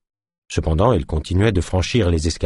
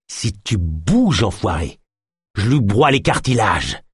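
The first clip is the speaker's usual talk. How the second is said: spat threat. Acted. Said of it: "cracha-t-il".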